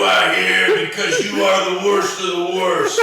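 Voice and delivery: loud mock prison warden; stern warden. Mock warden speech, shouted.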